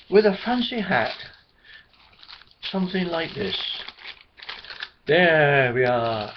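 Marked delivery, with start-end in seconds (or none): 5.1-6.3 showing off the hat. Shown as a demonstration. Teller shows the paper hat.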